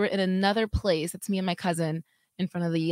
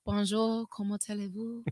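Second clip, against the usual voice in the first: put-on French accent; 9 dB quieter than usual. Cohost asks for French, she hams it up in a mock French accent. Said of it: "That's good".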